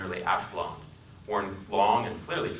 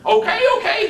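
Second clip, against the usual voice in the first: much higher, much louder hasty okay; panicked backing down; 12 dB louder than usual. Acted. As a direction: back off fast, spooked. Passenger backs down in panic.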